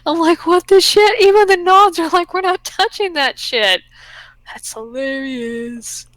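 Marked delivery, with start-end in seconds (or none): 0.1-3.8 high, loud, laughing through the words; laughing retelling. Retold or imitated. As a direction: retell it cracking up in disbelief. Laughing over retold refusal.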